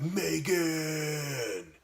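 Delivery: high, drawn-out call across the house; yelling for Megan. Retold or imitated. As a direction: call her name from the bedroom. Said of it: "I yell out for Megan".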